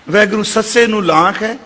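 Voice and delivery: usual speaking voice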